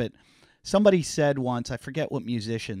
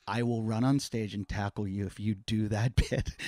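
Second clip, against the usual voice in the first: hushed threat; 4 semitones lower, 6 dB quieter than usual. Retold or imitated. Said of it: "she goes".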